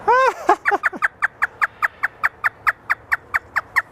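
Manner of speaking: very high shrieking laugh; hysterical laugh. Pretend. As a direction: cackle hysterically. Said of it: "Is it hysterical?".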